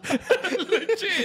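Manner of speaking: high laughing legit. Real, not acted. Laughing exclamation.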